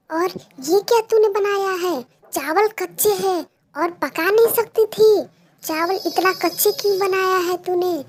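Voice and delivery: loud, high furious scolding; angry scolding mother. Acted. Mother yelling at Pinky over raw rice, furious.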